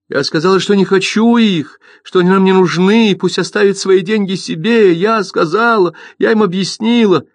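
high, pleading mother voice; anxious mother insisting; agitated mother. Acted.

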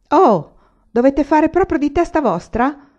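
high, loud, slow exasperated shout; impatient laughing yell. Acted. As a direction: yell at the stubborn oxen, half laughing. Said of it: "urlò il conducente spazientito, ma anche ridendo".

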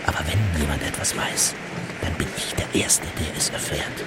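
sly, hushed whisper; secretive, knowing whisper. Acted. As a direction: let it slip as a whisper. Spy boasting in confidence.

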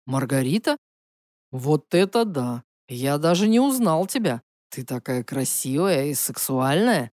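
low, oily charm of an ex. Acted. Emil's voice, low.

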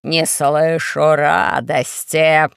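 low, very slow, annoyed troll demand; angry troll. Acted. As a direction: growl out the complaint slowly. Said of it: "рассердился тролль".

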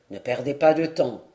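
a small man checking a rival, low and steady; the dwarf warning. Acted. Dwarf to the eager knight, low calm warning.

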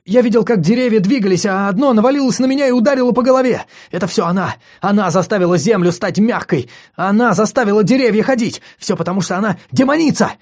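high, loud, fast feverish babble; frantic rush. Acted. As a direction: spill it out in a frenzy. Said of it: "рассказывать оживлённо и быстро".

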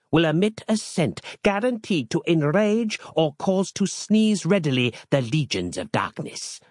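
pompous holy man offering a relic; a hint of a grave medieval brother; archaic monk Harland. A narrator's reading. Harland describes the herbs.

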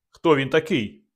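ordinary delivery

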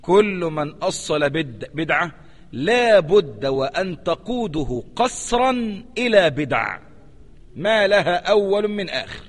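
very slow, word by word, "قسرا" leaned on; slow teacher's quotation; slow emphatic reading. Shown as a demonstration. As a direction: dictate it slowly so it sinks in. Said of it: "انتبه لهذا الكلام".

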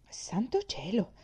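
slow, amazed and a little puzzled; admiring exclamation. Acted. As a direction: marvel at it, slowly. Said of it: "esclamò Jimmy ammirato". (esclamazione ammirata, lenta)

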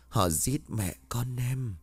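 slow, soft, hollow echo from the beyond; eerie ghost voice. A narrator's reading. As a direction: let the ghost's plea drift in from far off. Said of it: "anh nghe giọng nói của Hân bên tai". (giọng hồn ma vọng lại, chậm và xa xăm)

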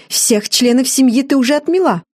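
high-pitched, irritated dismissal; peeved older woman's complaint; huffy snort. A narrator's reading. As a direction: toss it out huffily. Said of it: "фыркнула свекровь, избегая смотреть на меня".

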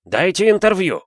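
high, loud demand shouted from afar; yelling. A narrator's reading. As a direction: reporter hollering for attention. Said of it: "орал хроникёр". (громкий высокий крик)

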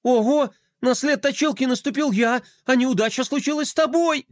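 high-pitched fretful wail; wailing lament. A narrator's reading. Brother lamenting his sister's bad luck, wailing. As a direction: fret aloud in high-pitched dismay. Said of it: "запричитал Флай".

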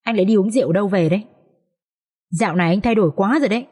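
ordinary delivery

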